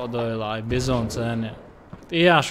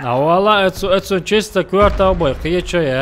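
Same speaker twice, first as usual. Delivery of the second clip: excited shouting; 5 semitones higher, 8 dB louder than usual. Real, not acted.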